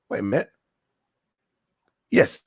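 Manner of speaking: ordinary talk, nothing marked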